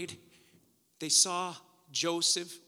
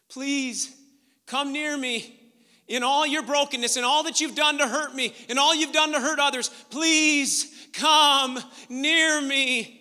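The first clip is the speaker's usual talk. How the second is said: impassioned plea; 7 semitones higher, 9 dB louder than usual. Acted. Joseph begging his brothers to come near, impassioned plea. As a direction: plead louder with every phrase.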